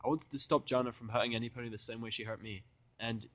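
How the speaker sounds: ordinary delivery, nothing marked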